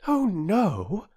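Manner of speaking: high-pitched shocked "oh no"; greatly shocked. Acted. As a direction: react as a horrified girl, pitched high. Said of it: "greatly shocked".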